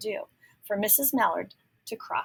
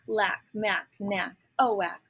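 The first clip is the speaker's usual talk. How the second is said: usual speaking voice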